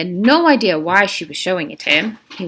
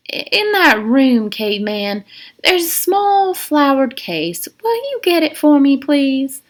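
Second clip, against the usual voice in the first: high, slow and sweetly menacing; honeyed command; warden's voice; 7 semitones higher, 3 dB louder, slower than usual. Acted. Warden to Stanley, high slow sugary request.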